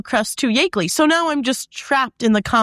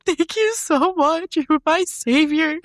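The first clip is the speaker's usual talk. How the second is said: slow, high, teary gratitude; retold tearful thanks; mock tearful thanks; 6 semitones higher, slower than usual. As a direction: gush thanks like you're crying. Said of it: "I started crying".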